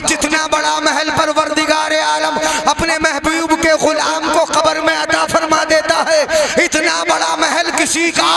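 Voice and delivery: climbing to a loud preacher's cry; building preaching. Acted. Preacher comparing palaces to the grave, rising sermon cry.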